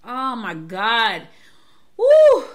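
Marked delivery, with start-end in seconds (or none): 2.0-2.5 a sudden loud, very high whoop; overwhelmed yelp at the news. Real, not acted. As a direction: let out a wild cry of alarm. Reacting to monkeypox news.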